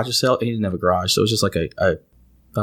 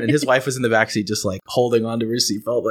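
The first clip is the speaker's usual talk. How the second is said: ordinary talk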